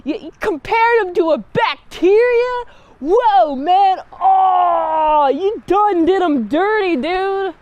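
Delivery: high, loud incredulous hoots; shocked bystander shouting over a brutal burn; stunned onlooker. Acted. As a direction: gasp at how harsh that rejection was, bro. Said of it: "And it's like".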